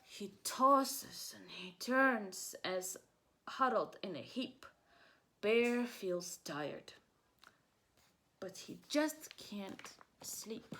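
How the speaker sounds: drawn-out read-aloud, fading to a hush; slow, sleepy storybook voice. A narrator's reading. Reading story text slowly.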